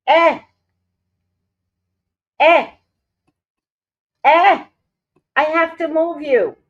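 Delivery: loud, high warning cries; loud shooing. Real, not acted. Owner shooing the cat off her desk, loud and high. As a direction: shoo the cat away firmly.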